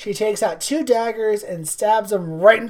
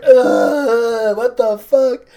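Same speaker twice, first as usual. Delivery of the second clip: mock disgusted shout; 3 semitones higher, 6 dB louder, much slower than usual. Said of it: "He's like".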